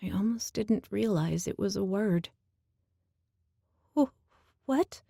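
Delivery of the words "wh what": frightened stammer. A narrator's reading. Startled by the Minotaur.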